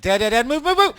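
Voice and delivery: loud, high urgent cries for Dad; frantic child. Retold or imitated. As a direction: imitate a kid urgently yelling for Dad. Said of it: "he tried, like".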